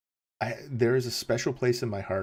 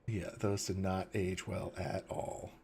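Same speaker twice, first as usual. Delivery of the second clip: softer and slower, settling the verdict; quiet, slow repeat; 3 semitones lower, 10 dB quieter than usual. Real, not acted. To chat about old movies, quiet and slow. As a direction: let it drop to a soft, slow murmur.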